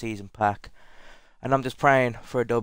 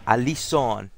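put-on name said in a playful French accent; 3 semitones higher than usual. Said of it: "saying it in a French accent".

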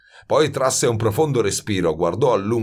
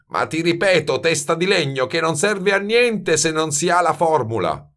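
higher, exasperated mild bellow; 9 semitones higher than usual. A narrator's reading. Master repeats himself to the blockhead, bellowing. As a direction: hammer it into a blockhead. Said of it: "muggì Baldini".

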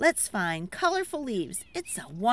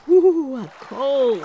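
1 loud shivery ooh; 3 semitones higher, 10 dB louder, slower than usual. Acted. Shivering at cold lake.

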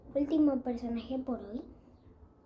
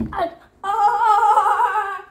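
sudden high yelp of pain; a sharp loud ouch; pained cry; 7 semitones higher, 10 dB louder than usual. Real, not acted. Phone falls, pained cry. (grito de dolor)

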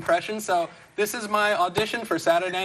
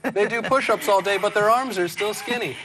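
ordinary delivery

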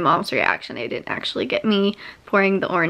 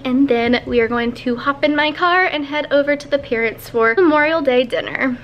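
usual speaking voice

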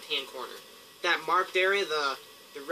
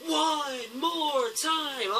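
drawn-out, high sing-song; 9 semitones higher, much slower than usual. Pretend. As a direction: stretch it out playfully. Teasing repeat.

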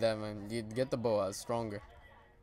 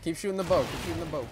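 urgent callout; 4 semitones higher than usual. Real, not acted. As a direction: push them to keep firing. Urging teammate in fight.

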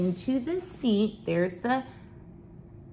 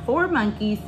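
ordinary delivery, nothing marked

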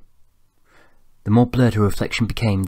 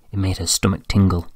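usual speaking voice

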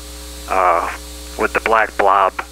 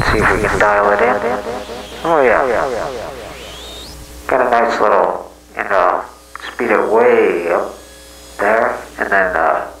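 echoing delayed voice through pedal. Real, not acted. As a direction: voice with tape-delay echo. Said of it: "let's throw a little delay on my voice".

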